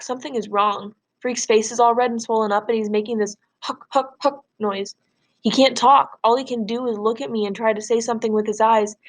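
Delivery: ordinary delivery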